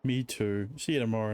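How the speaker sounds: ordinary talk, nothing marked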